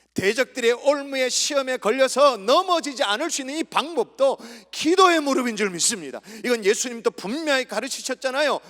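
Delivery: loud, high-pitched pulpit delivery; full-force preaching. Real, not acted. Preacher urges prayer against the enemies' snares, forceful preaching. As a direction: drive it home to the congregation.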